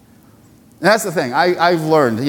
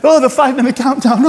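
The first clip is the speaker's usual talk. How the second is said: mock panic; 10 semitones higher, 4 dB louder, faster than usual. Rushed Sunday morning panic.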